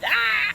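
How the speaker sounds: high loud pretend shriek; mock scream. Parent shrieking at the biting pig, mock fright. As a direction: squeal as if the pig bit you.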